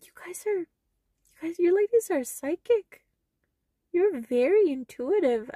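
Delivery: high, bright-eyed gushing at the ladies; excited compliment. Retold or imitated. Telling the mother and grandmother they're psychic, gushing high.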